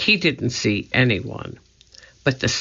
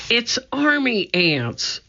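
alarmed cry; 10 semitones higher than usual. A narrator's reading. Jack warns Annie of the army ants, crying out. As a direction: cry out a warning, pitch jumping up. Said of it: "cried Jack".

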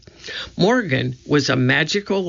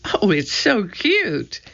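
slow, higher-pitched cooing; cooing delight; 5 semitones higher, slower than usual. A narrator's reading. Annie admiring the little mouse, slow delighted coo. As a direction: coo over a tiny animal, drawn out.

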